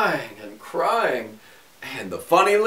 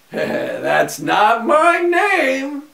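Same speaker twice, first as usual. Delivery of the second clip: high, slow, drawn-out teasing; a little man gloats, pitched high; gloating imp; 9 semitones higher, 5 dB louder, much slower than usual. A narrator's reading. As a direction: savour each word of the denial.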